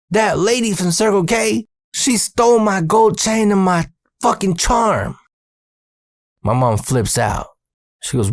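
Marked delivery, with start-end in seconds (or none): 0.1-5.2 put-on high, whiny tattling kid; higher upset kid voice. Kid reporting theft to mom.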